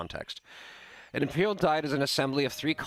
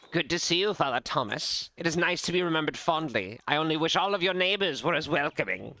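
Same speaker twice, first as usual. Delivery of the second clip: higher-pitched, genteel greeting; lightly voiced visiting lord; 7 semitones higher than usual. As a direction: play a courteous nobleman, pitched a little up.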